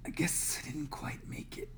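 faint, whispered and wounded; weak voice. Acted. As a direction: barely get the words out. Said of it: "he says in a weak voice".

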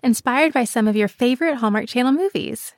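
polished, scripted sponsor read. Acted. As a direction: sell it like a radio spot.

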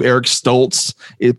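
normal talking voice

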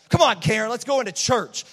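loud, impatient call to hurry; irritated hurry-up. Retold or imitated. Irritated dad hurrying his daughter into church. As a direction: snap at Karen to get moving.

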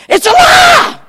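loud emphatic declaration; shouted verdict. Real, not acted. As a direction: slam the answer home. Punchline shouted.

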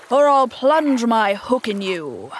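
slow, loud captain's threat; threatening cry. A narrator's reading. Peter as Hook threatening his crew, menacing cry. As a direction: threaten loudly, drawing the words out. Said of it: "cried Peter".

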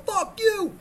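very high, loud screech; furious yelling. Retold or imitated. Injured patient answering nurse, furious scream. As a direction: scream it back in hammed-up rage.

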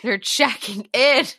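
slow, giggling through the words; laughing repeat. Retold or imitated. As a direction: laugh as you drag it out for the joke.

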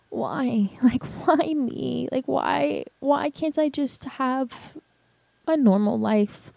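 high, slow, upset retold plea; distressed pleading. Daughter reacts to news of her bio dad, distressed pleading. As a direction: plead it high, dragging out each why. Retells hearing who her father is.

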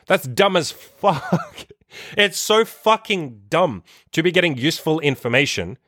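high, drawn-out incredulous stress on the swearing; real exasperated rant, stretching the words out; passionate exasperation. Real, not acted. Coach fed up with guys suppressing their emotions, passionately exasperated. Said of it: "emotional about this topic or passionate".

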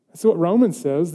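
ordinary delivery

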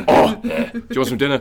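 grunted, caveman-like question; grunting caveman. Acted. How he talks to Kel, grunting about dinner. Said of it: "I just grunt mainly".

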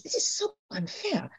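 mock sulky complaint, high and quiet; inner whine. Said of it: "the first thing you think is".

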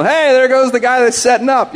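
mock excited bystander; acted onlooker. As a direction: call out like someone pointing at a passer-by. Onlookers' remark acted out in story.

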